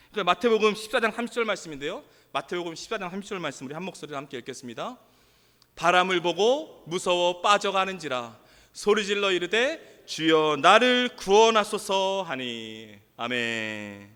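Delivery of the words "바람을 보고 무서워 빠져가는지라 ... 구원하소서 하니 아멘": slow, low congregational reading, swelling at the plea; scripture reading. Real, not acted. Preacher reads Peter's cry with the congregation, solemnly. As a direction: read it out in drawn, solemn phrases.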